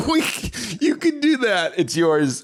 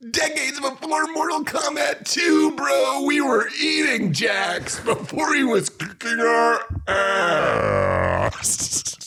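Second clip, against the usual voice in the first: higher, shouted hype; excited shouting; 7 semitones higher, slower than usual. Real, not acted. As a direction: yell it with glee. Excited rant about Mortal Kombat.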